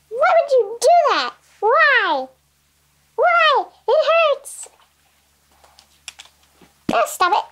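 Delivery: high-pitched whine, it hurts; high whiny complaint. Pretend. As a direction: whimper like a hurt toy. Toy cries after being hurt.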